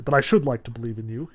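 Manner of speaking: ordinary talk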